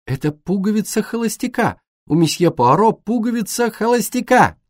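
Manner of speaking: put-on high, delighted girlish outcry; high-pitched, gleeful exclaiming; excited announcement. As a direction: announce the find to the whole table. Girl excitedly announces her find.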